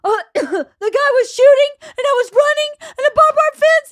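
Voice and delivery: high, loud hysterical wail, acted out; hammed-up tearful panic; mock crying. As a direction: blurt it out through pretend sobs. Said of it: "I just fall totally apart crying".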